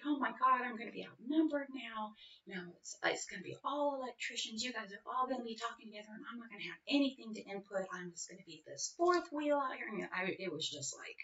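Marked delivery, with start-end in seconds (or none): 0.1-9.9 high, rushed, fretful self-quote; reliving the moment she panicked about being outnumbered; panicked outburst. Retold or imitated. Mother fretting to herself about the electricians, high and rushed. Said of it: "And then I was just like".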